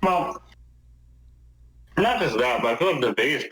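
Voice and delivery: talking while eating chips; words around a mouthful of chips; crunching chips. Real, not acted. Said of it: "sorry, I'm eating chips right now".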